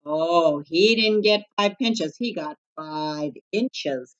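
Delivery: ordinary delivery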